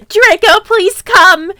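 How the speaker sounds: high, loud desperate plea; pleading call. Acted. Wrong take, pleading loudly to Draco. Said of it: "sorry, no, wait, that was a vampire".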